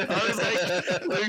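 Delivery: normal talking voice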